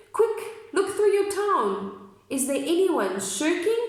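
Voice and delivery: high, lively storybook reading; reading aloud to children. Acted. Reading the book to the kids, lively and high. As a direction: call out to the Whos like an eager storyteller.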